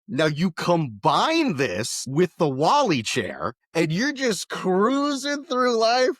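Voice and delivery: slow, high-pitched rapture; mock sales pitch. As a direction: sell the dream with drawn-out emphasis. Mock sales pitch for robot arms.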